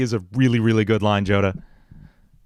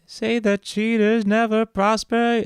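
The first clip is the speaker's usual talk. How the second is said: villain voice; 11 semitones higher, slower than usual. Acted. Villain voice line for game.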